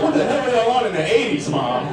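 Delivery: high, loud disbelieving yell, laughing; incredulous shout. Retold or imitated. Son hears horse tranquilizers story, shrieking disbelief. Son shocked at parents' drug past.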